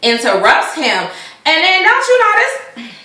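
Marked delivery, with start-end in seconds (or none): 1.5-2.9 pitched way up in shock; can you believe this, high and sharp; sudden high outrage; outraged disbelief. Real, not acted. Outraged lead-in.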